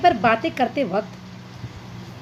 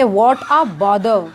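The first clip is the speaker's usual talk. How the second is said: slow teaching demo; 3 semitones lower, much slower than usual. Shown as a demonstration. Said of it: "तो English में कहेंगे".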